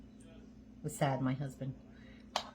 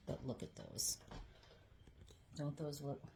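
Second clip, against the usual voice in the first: soft whispered look; 13 dB quieter than usual. Real, not acted. Showing food, hushed.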